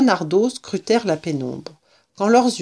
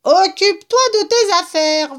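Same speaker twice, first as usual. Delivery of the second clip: very high rat squeal; vociferating rat; 13 semitones higher, 3 dB louder than usual. A narrator's reading. Tipsy rat snapping at Fox, high vociferating. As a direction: squeal it back indignantly. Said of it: "vociféra le rat".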